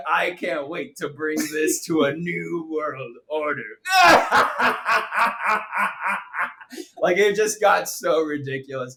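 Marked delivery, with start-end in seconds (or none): 0.1-3.6 slow, grandiose mock villain; mock maniacal proclamation; hammed-up villain. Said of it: "one second away from saying". 3.7-6.9 high, long villain laugh; hammed-up evil "mwahaha" laughter; mock evil cackle. Villain parody ends in cackle.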